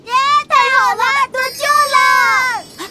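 loud happy cheering; joyful cheer. Acted. Rescued from the sea.

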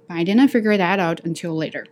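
ordinary delivery

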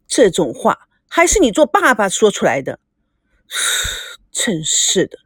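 usual speaking voice

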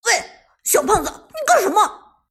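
high, loud shout of alarm; sudden indignant holler; flustered outburst. Acted. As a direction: yell at the food thief in a panic. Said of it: "陈天野顿时急了".

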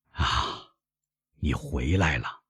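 put-on soft, slow old father; tender, smiling. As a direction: sigh softly and welcome your son home. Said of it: "老人微笑着说".

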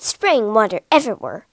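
ordinary delivery, nothing marked

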